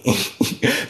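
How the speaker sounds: high, breathy unvoiced laugh; a short squeaky giggle; airy laugh. Real, not acted. Streamer laughs at society stealing from him, breathy giggle. Laughing at his own point.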